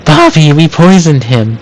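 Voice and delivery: high gasp, then alarmed; gasping shock. Acted. Linda horrified that Hugo is choking, high shocked gasp. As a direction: gasp and blurt in horror. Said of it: "Linda gasps".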